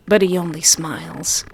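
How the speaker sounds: ordinary delivery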